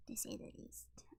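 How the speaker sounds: breathy whispered aside; whispering. Real, not acted. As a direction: drop to a whisper. Same phrase, now whispered.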